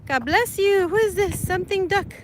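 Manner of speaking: bright welcome to new viewers; cheery greeting. Real, not acted. Greeting viewers in the chat by name, cheery.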